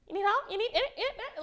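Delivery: high pushy salesclerk voice; pushy clerk imitation. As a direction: mimic a hovering store clerk. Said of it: "somebody just".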